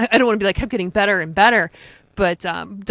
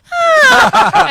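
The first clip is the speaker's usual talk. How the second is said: high, loud delighted squeal; fangirl squeal; 9 dB louder than usual. Retold or imitated. Reacting to Crosby side by side, loud squeal. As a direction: shriek like a starstruck fan.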